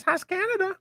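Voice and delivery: squeaky high question; silly high-pitched ask. Acted. High squeaky first take.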